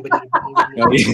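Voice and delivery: Johnny Morris voice. Retold or imitated. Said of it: "pickety pockety for Terence the Tractor".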